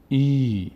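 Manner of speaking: ای as a sustained "ee"; long ee vowel. Shown as a demonstration. Teacher repeating the new reading, long "ee" on ای. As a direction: hold ای out long. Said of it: "کش داد".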